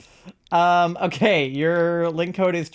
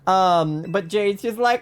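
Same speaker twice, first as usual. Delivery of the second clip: ordinary delivery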